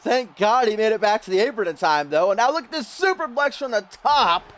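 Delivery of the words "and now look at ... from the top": excited play-by-play. Real, not acted. Calls a high-risk wrestling move.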